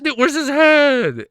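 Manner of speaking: high, loud, drawn-out disbelief; shocked outburst. Real, not acted. Streamer seeing a missing head, shocked outburst. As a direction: shout it slowly in amazement.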